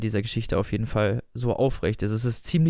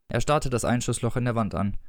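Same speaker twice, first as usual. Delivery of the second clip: ordinary delivery, nothing marked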